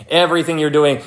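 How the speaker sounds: ordinary delivery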